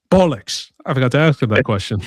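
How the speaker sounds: badly faked English accent. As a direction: put on a cheesy English accent. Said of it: "pretty bad English accent".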